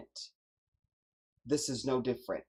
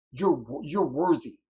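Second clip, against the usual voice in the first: Cory Booker impression; 3 semitones higher, 5 dB louder than usual. Host quoting senator to the nominee, mock-earnest impression. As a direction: parody a senator's overwrought sincerity. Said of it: "But there he is".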